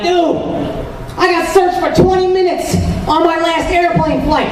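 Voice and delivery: high, excited bragging bro; imitating a brown friend. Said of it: "one of them will be like".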